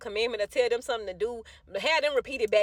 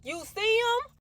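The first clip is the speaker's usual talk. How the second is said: high-pitched urgent question; 13 semitones higher, slower than usual. Retold or imitated. Mother asks maintenance man about missing kids, urgent questioning. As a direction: jump up high, desperate to know.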